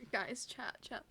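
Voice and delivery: normal talking voice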